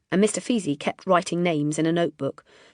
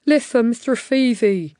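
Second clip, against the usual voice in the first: slight lisp; 6 semitones higher, 7 dB louder than usual. A narrator's reading. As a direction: lisp the s sounds, slowly. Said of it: "lisping slightly through his long teeth".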